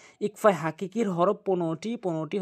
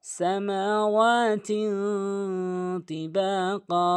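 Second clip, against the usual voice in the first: slow, higher, drawn-out tajweed chant; Quran recitation; 5 semitones higher, much slower than usual. Shown as a demonstration. Teacher recites the verse for learners, measured Quran recitation. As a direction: recite the verse with long held vowels.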